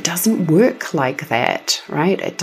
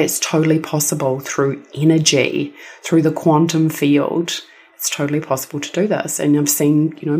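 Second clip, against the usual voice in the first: ordinary talk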